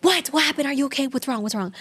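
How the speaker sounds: rapid-fire startled questions; dramatic worry. Retold or imitated. Mom woken by her daughter, dramatic worry. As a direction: imitate a mom jumping to alarm. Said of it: "so she's dramatic like that".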